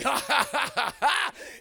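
exaggerated staccato belly laugh; long, hammed-up ha-ha-ha; re-enacted laughter. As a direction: act out laughing in someone's face. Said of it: "started laughing".

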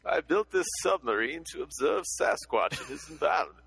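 put-on a light old newsreel announcer voice; 1950s narrator. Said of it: "That's, that's better".

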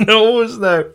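laughing echo. Real, not acted.

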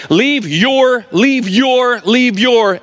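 "your" hit hard in "leave your", high and loud; emphatic leave your. Shown as a demonstration. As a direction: lean on "your", the emphatic Hebrew pronoun. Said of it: "in the Hebrew, it's emphatic".

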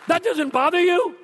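can't believe what he's hearing; incredulous son. Retold or imitated. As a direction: son asking his father in disbelief, high and loud. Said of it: "And he goes".

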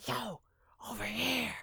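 high unvoiced hushed call; whisper shout. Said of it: "that whisper shout".

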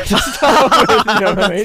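words squeezed out through a high, loud laugh. Real, not acted. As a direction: crack up mid-sentence. Laughing at own filming prank.

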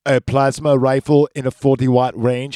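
deadpan machine, each word even and cold; Terminator impression. Podcaster retelling Terminator ordering a plasma rifle, deadpan robotic.

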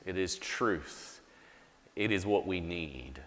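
soft, slow, hushed prayer voice; pastor's hushed prayer, fading to a whisper-soft amen. Real, not acted. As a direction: pray quietly and earnestly for the people. Leads congregation in prayer.

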